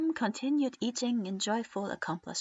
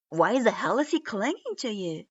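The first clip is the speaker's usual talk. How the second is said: louder incredulous shout; excited yell; 6 dB louder than usual. A narrator's reading. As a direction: shout in disbelief. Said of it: "tired of the excited yelling".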